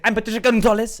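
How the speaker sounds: hammy reporter sign-off, name in Spanish; news reporter Spanish accent. Retold or imitated. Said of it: "those news reporters".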